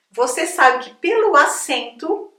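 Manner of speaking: ordinary talk, nothing marked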